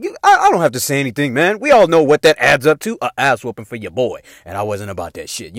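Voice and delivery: ordinary talk, nothing marked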